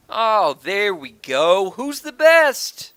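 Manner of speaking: loud, high, drawn-out gloating cheer; triumphant crowing. Real, not acted. Player celebrating a win in the game, triumphant crowing. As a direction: crow it slowly, soaring up at the end.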